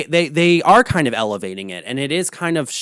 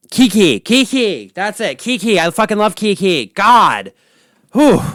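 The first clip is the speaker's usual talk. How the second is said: excited relief; 6 semitones higher, 4 dB louder than usual. Real, not acted. Finally remembering the name.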